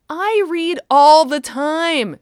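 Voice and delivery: put-on high, loud, slow sassy child. A narrator's reading. Daughter sassing her mother about reading, high and cheeky. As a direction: talk back to mother with cheek. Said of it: "she sassed".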